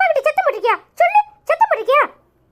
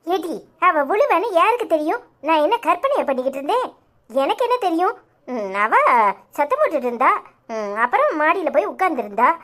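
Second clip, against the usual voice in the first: a lightly deepened husband justifying himself; 8 semitones lower than usual. Acted. Husband explaining to his daughter where he was, lightly deepened voice. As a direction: lower man's voice, explaining.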